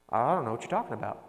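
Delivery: fast, brushing-off denial; mock denial. Crippled man brushing off Jesus, quick dismissive denial. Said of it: "Total denial".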